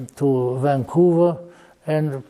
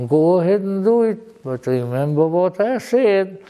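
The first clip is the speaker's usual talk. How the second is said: normal talking voice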